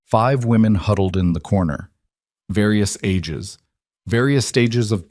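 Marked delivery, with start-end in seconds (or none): none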